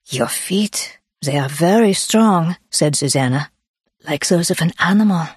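Swiss-accented wonder, gently voiced; admiring Swiss woman. Swiss woman examining a hiker's feet, admiring, Swiss accent. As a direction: marvel in a light Swiss accent.

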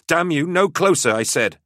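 high, loud desperate shout; crying out. Acted. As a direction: cry out in desperation. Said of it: "she cried".

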